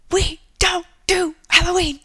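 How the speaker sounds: high, loud imitation of a scolding mom; halting, high-pitched mock yell; yelled translation. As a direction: shout it one chunk at a time. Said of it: "in her broken Japanese accent, which means".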